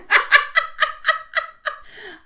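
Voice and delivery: high, loud peal of laughter; real laugh. Real, not acted. Teller amused at Bruno giggling asleep, laughing.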